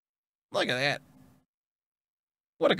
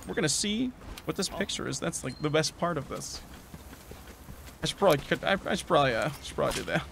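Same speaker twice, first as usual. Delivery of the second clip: soft afterthoughts; 3 semitones lower, 10 dB quieter than usual. Real, not acted. Winding down after the hunt.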